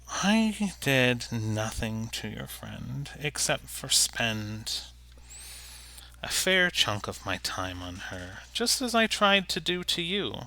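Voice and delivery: soft, understated disappointed goblin; lightly voiced goblin, measured and quiet. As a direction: protest softly, with a touch of threat. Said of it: "the goblin looked kind of disappointed".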